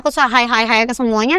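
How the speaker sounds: normal talking voice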